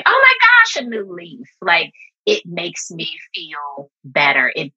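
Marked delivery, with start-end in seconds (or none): 0.0-1.5 high squeal of joy at a new leaf; talking to her plant, thrilled by a new leaf; delighted squeal. Retold or imitated. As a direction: gasp with delight. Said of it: "looking at my plant and saying".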